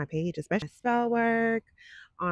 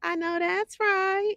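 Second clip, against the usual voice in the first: much higher, louder and slow, in sassy [name] style; 11 semitones higher, 4 dB louder, slower than usual. Retold or imitated. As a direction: stretch it out like [name] hyping a friend. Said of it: "In my [name] voice".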